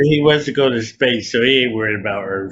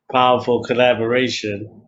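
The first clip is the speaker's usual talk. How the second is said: ordinary talk, nothing marked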